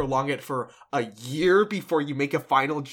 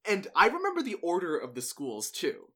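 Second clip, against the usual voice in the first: ordinary delivery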